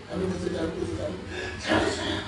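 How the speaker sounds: hushed, desperate repeated apology; pleading whisper. Retold or imitated. Pastor voicing his own pleading prayer to God, whispered. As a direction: whisper it like a child begging forgiveness. Acting out begging God for forgiveness.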